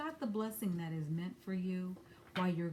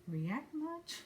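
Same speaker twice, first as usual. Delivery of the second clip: high, dry sarcastic jab; 9 semitones higher, 4 dB quieter than usual. Real, not acted. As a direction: needle them sarcastically. Mocking the overreaction.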